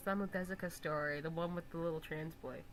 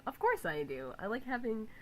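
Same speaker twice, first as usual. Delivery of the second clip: usual speaking voice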